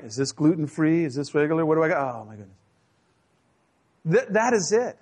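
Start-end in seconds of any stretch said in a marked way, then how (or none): 0.0-2.6 mock complaining. Mimics fussy communion complainer.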